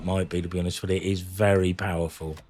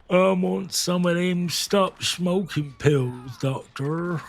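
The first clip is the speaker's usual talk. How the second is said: slow, higher put-on Southern US accent; hammed-up Southern US patient asking the doctor; 7 semitones higher, slower than usual. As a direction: drawl it out in a rough Southern American accent. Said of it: "I did that in that accent".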